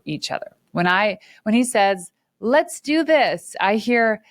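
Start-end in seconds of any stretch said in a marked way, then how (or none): 2.4-3.5 drawn-out, high, eager "Let's do this". Retold or imitated. Wife imitating husband's eager call, drawn-out and high. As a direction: stretch it out like a keen risk taker.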